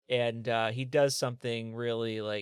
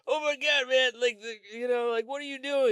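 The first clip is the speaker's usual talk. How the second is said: much higher, louder hammed-up alarm; mock panicked clerk; 11 semitones higher, 3 dB louder than usual. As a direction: play the cashier squealing in alarm.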